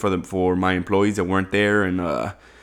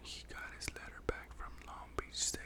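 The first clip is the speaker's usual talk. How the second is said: whisper; 27 dB quieter than usual. Acted. Host whispers the announced news.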